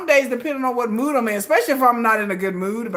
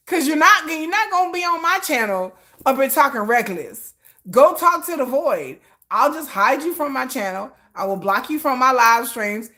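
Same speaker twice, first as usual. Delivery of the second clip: ordinary talk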